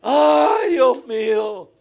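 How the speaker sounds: high, loud cry of alarm, slow on Dios mío; old woman on a rocking boat, screaming in mock fright; imitating a scream. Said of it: "La señora empieza a gritar".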